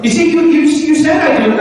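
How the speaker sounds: high, loud, accusing outburst; mock indignant shout. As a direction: fire it back like a wounded spouse. Husband quoting wife's complaint.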